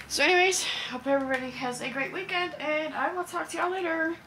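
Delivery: upbeat vlogger farewell; cheery sign-off. Real, not acted. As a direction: wave them off with a lift. Bubbly sign-off to viewers.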